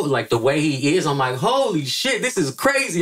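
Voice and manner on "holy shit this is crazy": high, stunned gasp of awe; starstruck holy shit. Retold or imitated. Fan meeting his hero, high starstruck squeal.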